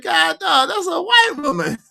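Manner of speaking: very high, stunned exclamation; retold shock. Host retelling his replay moment, stunned. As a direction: gasp it out in disbelief. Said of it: "I said".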